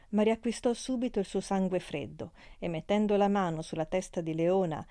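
ordinary delivery

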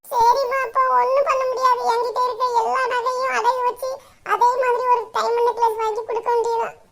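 put-on high girlish voice with a rueful laugh; resigned daughter. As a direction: accept the plan with a wry laugh.

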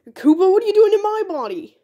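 high-pitched startled outcry. Acted. Body-swapped Mario confronts Koopa, high and startled. Swapped characters shout in surprise.

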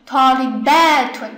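Talibatun with a long, heavy BA; stress on BA. Shown as a demonstration. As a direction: punch and stretch ba in Talibatun. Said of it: "重音在这里".

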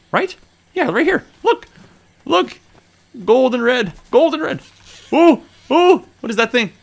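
very high, loud excited squeals; thrilled squeal. Real, not acted. Gamer spotting the tree, squealing with delight. Finding the maple trees, thrilled.

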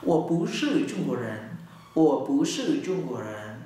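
slow, deliberate denial; slow Mandarin: I'm not Chinese; clear slow statement. Shown as a demonstration. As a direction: say it slowly and clearly. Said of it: "mình nói rõ luôn".

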